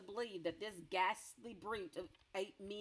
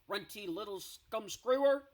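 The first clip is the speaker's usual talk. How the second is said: louder, insulting bellow; giant shout; 2 semitones higher, 9 dB louder than usual. Acted. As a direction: spit the insult. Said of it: "he shouted".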